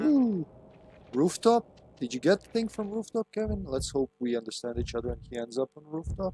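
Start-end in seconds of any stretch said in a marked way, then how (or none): 0.0-3.6 high, eager call to a teammate; excited high call. Real, not acted. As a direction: call out brightly, voice up.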